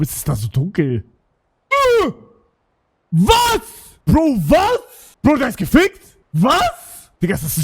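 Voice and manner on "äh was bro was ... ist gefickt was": high, loud startled cries; stunned what, much higher; shocked disbelief. Real, not acted. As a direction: blurt it out in shock. Shocked reaction to stream.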